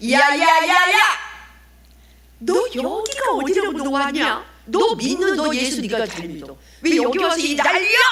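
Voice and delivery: high, loud acted outburst; angry scolding. Temple grandmother to the returning evangelist, high angry scolding.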